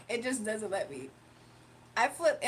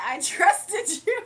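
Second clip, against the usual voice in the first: laughing; 8 semitones higher, 9 dB louder, much slower than usual. Retold or imitated. Quoting chat, laughing loudly.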